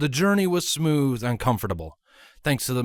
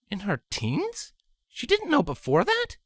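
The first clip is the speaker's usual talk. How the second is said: very high, astonished questions; surprised; 13 semitones higher than usual. A narrator's reading. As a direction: gasp it out in disbelief. Scarlet surprised.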